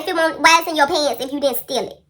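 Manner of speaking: loud, high-pitched mock scolding; accusing mother. Mom accuses child of stealing, angry. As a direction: imitate mom yelling the accusation.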